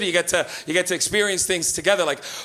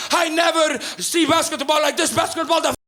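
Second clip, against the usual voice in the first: excited Portuguese dad; 7 semitones higher than usual. Retold or imitated. Dad on phone thrilled by basketball, accented gushing. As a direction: gush like a new fan. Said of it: "he goes".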